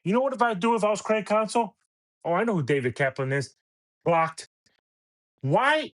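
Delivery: ordinary talk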